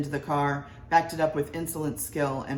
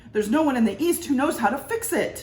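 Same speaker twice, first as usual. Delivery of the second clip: louder, higher panicked intern; shouting intern; 9 semitones higher, 6 dB louder than usual. A narrator's reading. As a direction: call out in alarm over the machine. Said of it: "shouted an intern".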